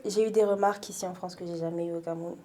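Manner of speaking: usual speaking voice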